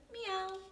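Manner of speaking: very high meow; cat meowing. A narrator's reading. Cat answers Benny with a high mew. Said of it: "said his cat".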